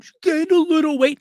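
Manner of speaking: high, prissy mocking voice; prim correction. A friend softening it, high and prissy. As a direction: mimic a polite euphemism snootily. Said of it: "And typical one".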